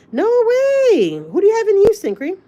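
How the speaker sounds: loud, high-pitched delighted disbelief; real high, loud excitement; excited surprise. Real, not acted. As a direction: shriek it, thrilled by the news. Reacting to a viewer's surprising news.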